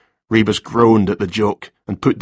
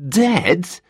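high-pitched, stunned question; high, startled exclamation at bad news; shocked gasp; 9 semitones higher than usual. A narrator's reading. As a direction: gasp it in disbelief. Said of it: "he gasped".

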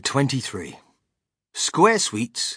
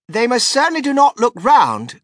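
high, loud outraged protest; indignant cry; 11 semitones higher, 10 dB louder than usual. A narrator's reading. Veruca arguing with Wonka about the sweets, crying out. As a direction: cry out in flat contradiction, high and forceful. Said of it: "cried Veruca Salt".

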